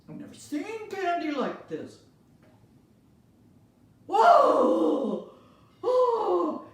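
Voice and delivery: very high, very loud wow; amazed principal. Acted. Principal tastes candy, very high loud amazement. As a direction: blown away by the candy.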